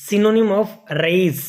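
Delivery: slow, loud and high, each word drawn out; slow dictation. Shown as a demonstration. Teacher reads the quiz question to students, slow and loud. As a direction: read the question out slowly for the class to copy.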